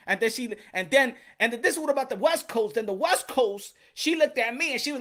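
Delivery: ordinary delivery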